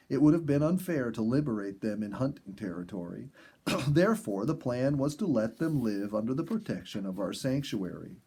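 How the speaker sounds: plain reading voice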